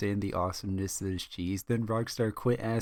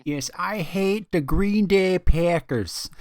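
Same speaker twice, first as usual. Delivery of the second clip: put-on loud, high, drawn-out Wisconsin accent; hammed-up, badly faked Wisconsin accent; 8 semitones higher, 8 dB louder, slower than usual. Joking to friends about a football team, terrible Wisconsin accent. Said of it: "worst Wisconsin accent I've ever".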